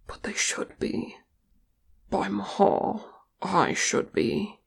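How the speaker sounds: soft, half-whispered confession; hushed admission. A narrator's reading. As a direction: let it out under the breath. Thorin quietly admitting self-blame.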